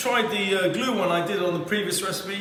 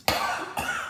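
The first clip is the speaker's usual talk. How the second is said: spluttering cough after a hot sip; 6 dB quieter than usual. Real, not acted. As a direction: cough from a burning drink.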